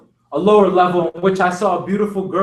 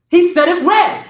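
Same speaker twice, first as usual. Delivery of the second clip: high, louder preacher's cry; 8 semitones higher, 5 dB louder than usual. A narrator's reading. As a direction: ring it out over the pews. Preacher shouting in sermon.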